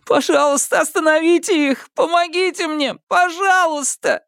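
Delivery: put-on high, frantic boy begging for mercy; мольба о пощаде. A narrator's reading. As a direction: plead wildly, voice straining up. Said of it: "продолжая умолять о пощаде". (отчаянная мольба о пощаде)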